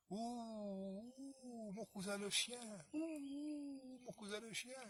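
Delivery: voix de loup aiguë et plaintive, hululée; loup qui appelle. Acted. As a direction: hurle doucement comme un loup affamé. Le loup appelle le chien dehors. (le loup appelle son cousin d'une voix plaintive)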